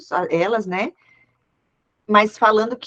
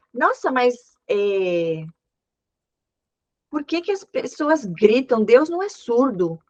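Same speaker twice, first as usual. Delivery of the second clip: scornful remark; 3 semitones higher, slower than usual. Retold or imitated. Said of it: "sabe o que ela falou?".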